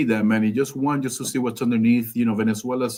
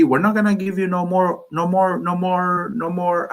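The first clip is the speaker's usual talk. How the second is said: put-on higher-pitched Cuban-accented tirade; 8 semitones higher than usual. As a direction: play an official with a Cuban accent. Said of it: "he would have a Cuban accent".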